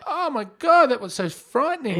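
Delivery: mock frightened owner; frightened woman. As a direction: imitate a shaken dog owner. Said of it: "She's still like".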